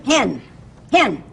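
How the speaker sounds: ordinary delivery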